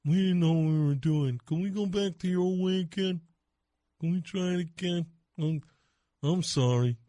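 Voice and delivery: small, contrite mock kid voice; sheepish child. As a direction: mumble a shamefaced apology like a child. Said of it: "They'll sheepishly come back".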